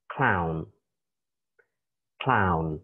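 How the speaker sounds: "clown" said clearly as /klaʊn/ with a falling end; teacher demo of "clown", each sound distinct; model pronunciation. Shown as a demonstration. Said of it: "So in English, it is".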